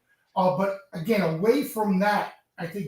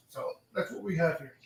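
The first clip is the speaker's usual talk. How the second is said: echoey, distant room sound; like talking from inside a cave; 4 semitones lower, 7 dB quieter than usual. Real, not acted. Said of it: "you sound like you're in a cave".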